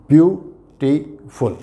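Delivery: Beautiful said slowly, syllables separated; slow beautiful for counting syllables; teacher spells out beautiful by sound, slowly. Shown as a demonstration. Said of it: "तीन syllable का word है".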